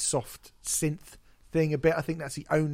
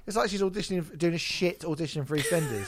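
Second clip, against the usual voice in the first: usual speaking voice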